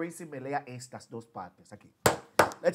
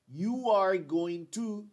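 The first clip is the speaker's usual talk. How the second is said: slow, louder full form, stress on "are"; slow long form; 6 semitones higher, 7 dB louder, slower than usual. Shown as a demonstration. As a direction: lean on "are", drawn out. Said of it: "Aquí yo digo".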